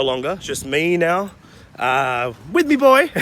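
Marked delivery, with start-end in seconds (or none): 2.5-3.1 pitched way up, teasing; high teasing voice. Acted. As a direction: squeak it out playfully. Jokey aside about roommate.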